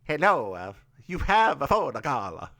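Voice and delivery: hammed-up cartoon Italian accent; bad Mario-style Italian accent with added vowels; terrible Italian accent. Co-host mocks own Ramon voice, fake Italian accent. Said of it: "That's a terrible Italian accent".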